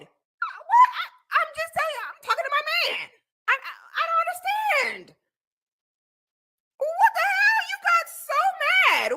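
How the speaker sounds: very high, squeaky protest; squealing mock bewilderment. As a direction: squeal in exaggerated disbelief. Mock bewilderment at someone getting mad.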